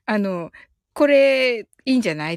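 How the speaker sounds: normal talking voice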